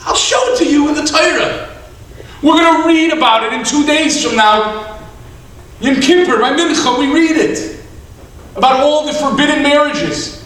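loud, high-pitched insistence; insistent argument. Acted. Rabbi proving to the man it is forbidden, insistent.

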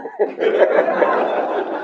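short breathy laugh, unvoiced; laughter. Real, not acted. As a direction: laugh at the memory. Laughs at his own story.

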